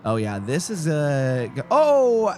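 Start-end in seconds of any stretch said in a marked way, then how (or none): 1.7-2.3 surprised yelp. Real, not acted. Streamer reacts to own mistake.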